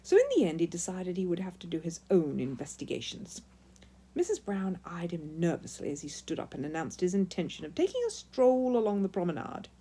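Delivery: ordinary delivery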